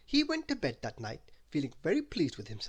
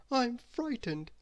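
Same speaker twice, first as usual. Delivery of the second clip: high, slow timid voice; Mister Dizzy, lost on dark cliffs, in a small frightened voice; 10 semitones higher, slower than usual. A narrator's reading. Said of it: "in a small voice".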